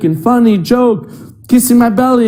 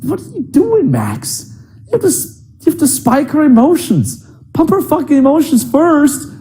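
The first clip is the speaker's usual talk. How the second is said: inner voice pep talk; 8 semitones higher than usual. Retold or imitated. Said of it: "And I realized, like".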